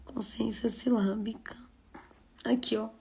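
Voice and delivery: unvoiced whisper under her breath; whispered aside. Real, not acted. Teacher finding the right slide, whispered to herself. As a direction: murmur the title to yourself.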